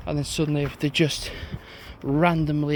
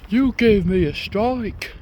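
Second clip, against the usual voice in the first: drawn-out, raised mocking voice; unintelligent-sounding girl; 5 semitones higher, 4 dB louder, slower than usual. Girl in Morrison's to the vlogger, unintelligent-sounding mimicry. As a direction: mimic her slowly, higher and louder. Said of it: "they didn't sound very intelligent".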